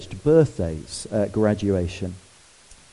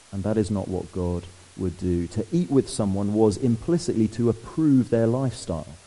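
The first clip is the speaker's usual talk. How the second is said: ordinary delivery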